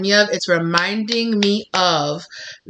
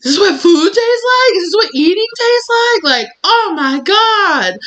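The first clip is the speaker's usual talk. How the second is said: very high, loud tearful wail of delight; overwhelmed amazement; 14 semitones higher, 7 dB louder than usual. Acted. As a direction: cry out as if tasting for the first time. Acts first taste of food.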